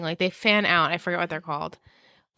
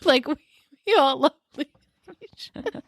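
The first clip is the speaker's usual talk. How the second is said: higher, words breaking up in laughter; laughing; 6 semitones higher, slower than usual. Real, not acted. Explaining a nickname to the chat, helplessly laughing. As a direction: try to get the pun out through giggles. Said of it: "here I am laughing again".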